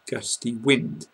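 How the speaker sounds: ordinary delivery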